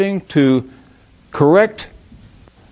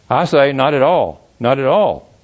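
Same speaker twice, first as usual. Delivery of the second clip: ordinary delivery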